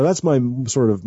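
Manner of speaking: usual speaking voice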